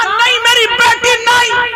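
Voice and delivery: high, pleading mother's cry. Acted. Mother begging her daughter to stay, high pleading cry. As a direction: beg her daughter not to go, high and urgent.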